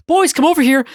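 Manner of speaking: lightly voiced mother calling; mom calling out. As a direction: call the kids like a mom. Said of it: "she goes like".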